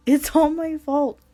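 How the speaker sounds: high, loud, slowed wail; anguished sobbing cry. Acted. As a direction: wail the blame on yourself. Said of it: "I cried out".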